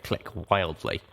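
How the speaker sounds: ordinary delivery, nothing marked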